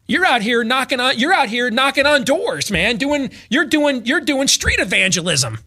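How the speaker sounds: ordinary talk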